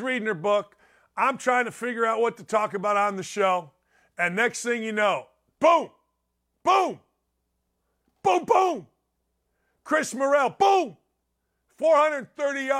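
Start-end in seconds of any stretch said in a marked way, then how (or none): 5.6-12.7 loud booms, much higher; host reliving Cubs home runs, excited; excited home run call. Real, not acted. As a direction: shout each boom like a play-by-play man.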